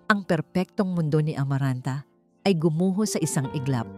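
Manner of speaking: ordinary delivery, nothing marked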